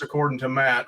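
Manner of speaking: usual speaking voice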